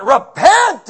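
high, loud wild prophet shout; yelling. Retold or imitated. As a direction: bellow it from the woods. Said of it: "No, he's like".

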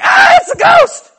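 hammed-up horror movie panic; mock terrified scream. Disciples seeing a figure on the water, in a mock terrified scream. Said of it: "And they're like us".

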